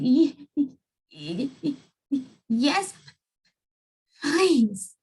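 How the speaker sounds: high shaky stutter; quivering stammer. A narrator's reading. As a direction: stammer yes while trembling. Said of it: "quivered and shook".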